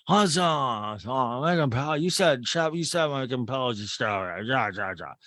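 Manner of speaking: slurred, mushy-mouthed mock speech, loud and repetitive; Craig Conover. Podcaster showing listeners how he speaks, mocking garbled impression. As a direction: garble the words in a caricatured whine of vowels. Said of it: "How does Craig Conover speak".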